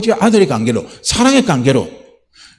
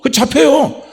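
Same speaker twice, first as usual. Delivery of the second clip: ordinary talk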